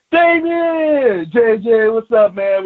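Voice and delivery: high, loud happy call across the room; excited greeting. Retold or imitated. Greeting an old friend in the locker room, high and loud.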